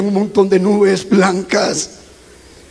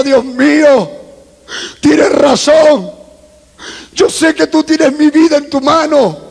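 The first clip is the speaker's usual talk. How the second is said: tearful plea; 7 semitones higher than usual. Retold or imitated. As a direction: cry out to God, stretching the words. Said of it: "Y llorando le decía". (súplica llorosa, aguda y alargada)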